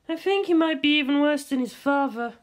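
put-on high, wry young woman; grimacing Misato. A narrator's reading. Misato to Ritsuko about Shinji, high and wry. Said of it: "Misato said with a grimace".

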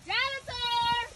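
long, high-pitched holler; calling out. Real, not acted. Searching for the lost leader, high drawn-out call. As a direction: send the word out high and slow.

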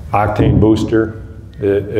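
ordinary delivery

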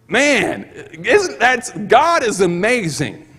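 preacher exulting to the congregation; exultant praise. Real, not acted. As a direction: burst out praising.

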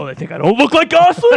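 high, loud offended rant; playful outraged shout; mock outrage. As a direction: blow up in hammed-up indignation. Acting out offended cop's reaction.

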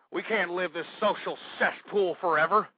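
ordinary talk

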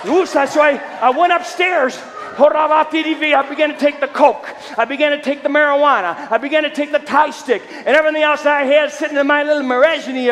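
loud, high revival preacher delivery; high-pitched, driving pulpit shout; full-force preaching. Real, not acted. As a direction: belt out the testimony like a fired-up preacher.

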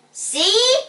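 high, loud "see?"; frustrated girl proving her point; exasperated. A narrator's reading. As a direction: shoot it back, pitch right up.

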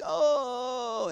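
high imitated groan of pain; pained moan. Sick mother lying on the floor, imitated pained moan. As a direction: let out a mother's hurting moan. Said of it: "she's moaning, moaning".

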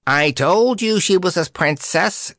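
high-pitched weathercock boast; triumphant crow. A narrator's reading. Weathercock proved right about Dorothy, triumphant crow. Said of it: "crowed the weathercock triumphantly".

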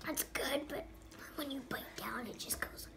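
hushed whisper with candy in mouth. Real, not acted. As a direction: whisper it under the sour rush. Whispering while eating candy.

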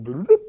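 little dialogue box bloop; imitated pop-up sound. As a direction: mimic a computer alert popping up. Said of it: "like a little dialogue box popping up".

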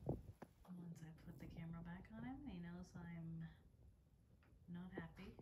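soft whispered explanation. Real, not acted. Owner explaining to viewers, soft whisper. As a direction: whisper so the bird won't hear.

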